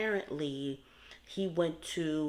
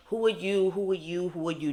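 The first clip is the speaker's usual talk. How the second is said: imitating Peter; 4 semitones higher, 6 dB louder than usual. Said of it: "Peter's like".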